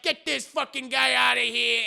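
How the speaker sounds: acted irritated shout; angry mobster order. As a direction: throw him out like an irate mobster.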